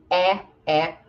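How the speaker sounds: isolated "é" as a wide eh; open E. Shown as a demonstration. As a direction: say "é" twice, open as in bet. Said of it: "também tem este som".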